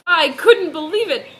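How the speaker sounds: ordinary delivery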